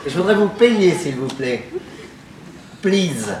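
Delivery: timid request. Acted. Customer timidly asks the waiter to pay, soft and hesitant. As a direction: ask to pay, almost shyly.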